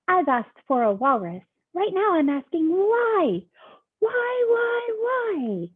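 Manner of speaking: high, loud pigeon listing his asks; a whiny pigeon building his case; pigeon ranting. Acted. Pigeon's long rant.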